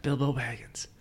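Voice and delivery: soft whispered echo; hushed, voiceless little aside. Real, not acted. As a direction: whisper it under the talk. Amused echo of the name, aside.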